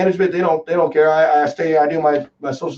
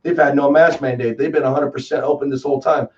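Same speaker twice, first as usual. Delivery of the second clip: usual speaking voice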